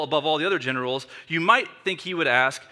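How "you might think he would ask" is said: ordinary talk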